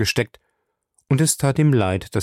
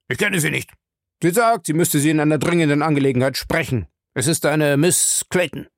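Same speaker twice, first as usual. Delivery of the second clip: higher, brisk subordinate's voice; night-duty officer; 5 semitones higher than usual. Acted. Officer reports Miss Clayton to Jim, in a higher, brisk voice. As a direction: report it promptly to a superior.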